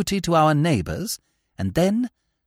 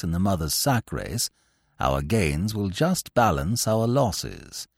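lower, softer, measured valet; unflappable valet; 10 semitones lower, 4 dB quieter than usual. Acted. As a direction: keep it calm and deferential. Jeeves answers Bertie.

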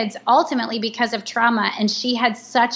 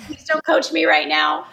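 mock exasperated plea; 6 semitones higher than usual. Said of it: "Nate hears me say".

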